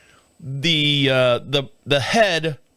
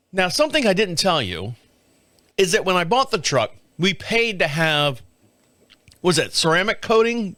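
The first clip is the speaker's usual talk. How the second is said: usual speaking voice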